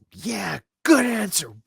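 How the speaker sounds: high, drawn-out cheer; game show cheering. Retold or imitated. Audience member cheering every Family Feud answer, high holler. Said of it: "I'm doing this".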